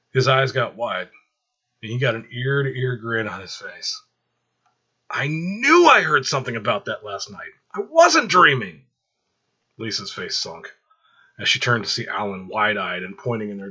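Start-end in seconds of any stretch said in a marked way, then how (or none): 5.1-8.9 rising, louder and slower with glee; thrilled to have caught the secret; excited. Acted. Alan gloating to Michael over overheard news, loud and gleeful.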